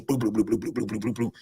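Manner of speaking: rapid low, soft bloops; imitated tumbling. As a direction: burble it like someone bouncing downstairs.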